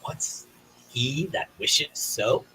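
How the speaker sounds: slow, measured stage delivery of Henry's line; Shakespeare recitation. Acted. Actor starting his Henry V monologue, slow and formal. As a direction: launch the monologue like a king addressing his court. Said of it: "so here we go".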